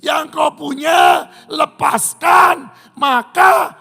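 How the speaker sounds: loud, high preaching shout; full-force preaching. Real, not acted. Preacher roaring at the congregation about giving. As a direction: preach it hard to the crowd.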